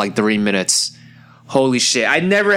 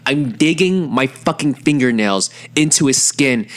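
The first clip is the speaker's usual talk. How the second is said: ordinary talk, nothing marked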